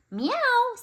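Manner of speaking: high mewing cat sound; high cat meow. Acted.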